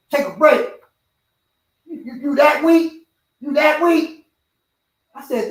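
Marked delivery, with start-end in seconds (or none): 0.1-4.3 loud, high, incredulous challenge; retold coach jeering in disbelief; scornful coach. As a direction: throw it out as a loud, high taunt. Coach berating him in retold story.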